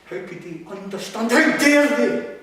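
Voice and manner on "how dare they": much higher and louder, slow and furious; outraged cry. Acted. Raging at the people who judge her, a slow furious cry. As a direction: hurl it at them in outrage.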